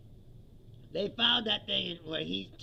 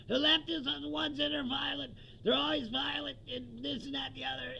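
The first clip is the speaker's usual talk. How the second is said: higher mock voice of a TV pundit; mocking Gutfeld; 9 semitones higher than usual. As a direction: parody the pundit's smug claim.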